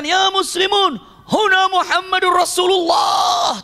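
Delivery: very slow, high drawn-out call; shouting call. Retold or imitated. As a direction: summon the whole crowd, stretched long and high. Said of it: "Maka berteriaklah". (seruan panjang bernada tinggi)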